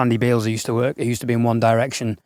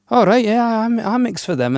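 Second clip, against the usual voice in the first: naive eager reply; 10 semitones higher than usual. Retold or imitated. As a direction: play the bumbling eager newbie, overexcited. Mocking partner's naive eager reply.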